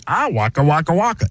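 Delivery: Muppet bear catchphrase voice; Fozzie Bear. Acted. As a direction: do a comic puppet bear. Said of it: "And then Fozzie Bear".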